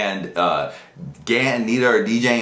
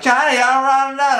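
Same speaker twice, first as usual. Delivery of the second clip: friend at party; 11 semitones higher than usual. Retold or imitated. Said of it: "people would be like".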